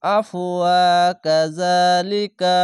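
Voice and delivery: আফওয়া joined to কাযালিকা, slow chant; joined recitation. Shown as a demonstration. Teacher repeats the joined reading for students. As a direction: flow the words together slowly. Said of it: "এভাবে মিলিয়ে পড়বেন".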